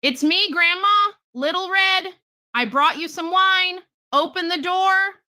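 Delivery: put-on wolf putting on a sweet child voice; wolf at the door faking Little Red's voice; wolf faking a girl. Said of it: "He said".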